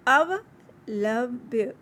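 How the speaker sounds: अवलंब्य drawn out very slowly; slow teacher demo of अवलंब्य; slow pronunciation. Shown as a demonstration. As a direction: break अवलंब्य into slow parts. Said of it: "अवलंब्य मीन देरी न करना".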